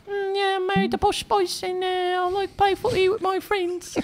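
high, soft mock Beckham voice; imitating David Beckham. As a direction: hold the high, gentle Beckham voice. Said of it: "but he was like".

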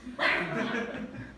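throaty imitated pigeon coo; pigeon impression. Comedian shows audience his pigeon impression, cooing. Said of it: "That's my pigeon impression".

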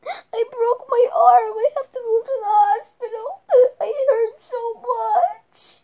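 high, loud, slowed sobbing; crying. Pretend. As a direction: cry out every word in pain. Doll cries about broken arm.

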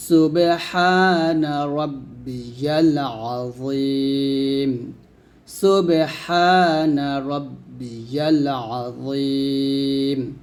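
সুবহানা with a bounced b; slow recitation with a bounced b in সুবহানা; qalqala on সুব. Shown as a demonstration. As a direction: echo the b of সুবহানা. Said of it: "এখানে অবশ্যই কলকলা আদায় করবেন".

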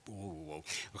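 usual speaking voice

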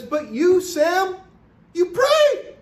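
high, impassioned cry rising sharply; worked up. Real, not acted. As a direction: build to a soaring, emphatic peak on "pray". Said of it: "I'm getting worked up here".

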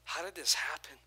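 softly amazed, voice dropping; hushed awe. Retold or imitated. Preacher showing the congregation awe of God, hushed. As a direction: breathe out the wonder quietly. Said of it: "When you're just like".

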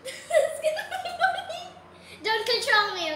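high, loud giggly voice; giggling. Real, not acted. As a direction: squeal it through giggles.

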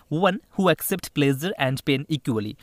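very slow, word by word; slow re-read. Shown as a demonstration. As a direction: spell the sentence out again for students. Teacher re-reading the question.

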